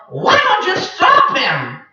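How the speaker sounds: high, slow parrot prodding; urging parrot. Acted. Papagayo urges the creatures.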